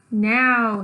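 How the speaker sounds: loud sharp call; mom yelling. Acted. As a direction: snap it out like a fed-up mom. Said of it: "yells my mom".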